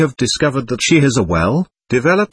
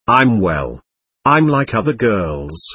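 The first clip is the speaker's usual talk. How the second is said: plain reading voice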